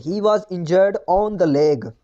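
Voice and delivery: very slow reading of the faulty line; slow wrong example. Shown as a demonstration. Teacher slowly reads the wrong sentence to students. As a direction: read the mistake out word by word. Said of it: "error है".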